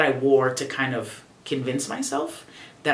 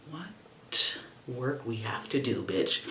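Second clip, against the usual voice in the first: low, soft, slow awed murmur; quiet dawning truth; hushed realization; 5 semitones lower, 9 dB quieter, slower than usual. Retold or imitated. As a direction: say it under your breath, stunned.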